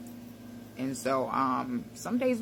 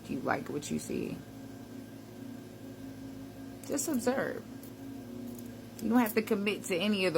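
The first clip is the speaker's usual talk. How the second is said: gentle, unhurried coaching voice; guided reflection; 6 dB quieter than usual. Acted. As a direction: lead them calmly through the mirror check. Guides a mirror self-check.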